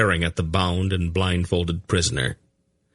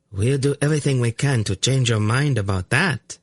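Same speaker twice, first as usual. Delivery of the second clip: chillingly sweet; 6 semitones higher than usual. A narrator's reading. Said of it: "in a chillingly sweet voice".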